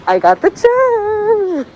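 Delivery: high and slow, thrilled; bursting with happy news; excited. Real, not acted.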